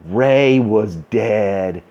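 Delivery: normal talking voice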